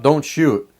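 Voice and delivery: ordinary delivery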